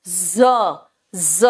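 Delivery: "ज" said as a buzzing "zzuh", each on its own; isolated Z sound. Shown as a demonstration. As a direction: demonstrate "ज" as Z, drawn out and separate. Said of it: "जा अलग है और ज अलग है". ("ज" को ज़ की तरह अलग से)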